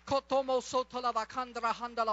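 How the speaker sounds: loud, fervent rush of tongues; praying in tongues. Real, not acted. Preacher breaking into tongues before the congregation after healing prayer, fervent. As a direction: burst out in spirit-filled syllables.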